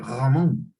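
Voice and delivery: "Ramón" with a throaty gh for the r; r of "Ramón" as a guttural gh; distorted r. Shown as a demonstration. Teacher demoing distortion: "Ramón" with guttural r. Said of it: "la R no está pronunciándose correctamente".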